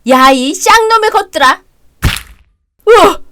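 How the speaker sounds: mother-in-law exploding at her son and husband; furious yell. Acted. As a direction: scream it at the drunk men.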